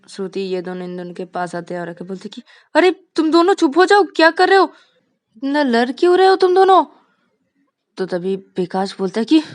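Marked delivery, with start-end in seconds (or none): 2.7-6.8 high, loud call to stop fighting; loud scolding. Acted. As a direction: shout them into silence. (ऊँची आवाज़ में डाँट)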